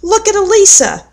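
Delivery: high, tattling mock shout. A narrator's reading. Brother tattling to Mom about the necklace, high shout. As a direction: pitch it up like a tattling boy. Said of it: "Russell shouted".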